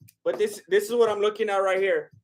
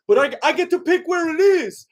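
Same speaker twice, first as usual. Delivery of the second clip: imitating Woodley; 9 semitones higher, 6 dB louder than usual. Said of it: "Woodley was like".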